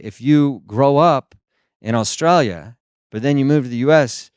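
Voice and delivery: normal talking voice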